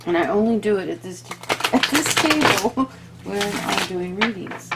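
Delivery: hoarse, fading voice; losing voice. Real, not acted. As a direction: push through a failing throat. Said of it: "I keep losing my voice".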